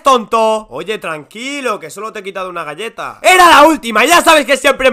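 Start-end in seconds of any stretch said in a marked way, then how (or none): none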